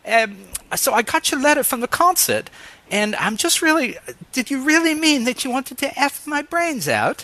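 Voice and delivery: imitating an editor's fake British accent; mock British accent. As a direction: fake pop-star charm over the phone. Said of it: "I do a great British accent".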